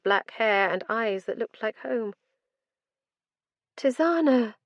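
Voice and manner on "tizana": higher, gently calling a name; a mother calling her child. A narrator's reading. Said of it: "she called to me".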